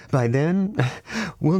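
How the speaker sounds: a light chuckle breaking the line; soft laugh. A narrator's reading. Nadia reassuring her brother, laughing softly. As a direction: laugh gently at the broken door. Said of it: "laughing softly".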